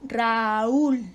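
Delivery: "Raúl" stretched, ra-úl; lengthened "Raúl", landing on the ú; stress on the ú. Shown as a demonstration. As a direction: sound out "Raúl" slowly with weight on úl. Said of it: "la sílaba tónica cae en la débil".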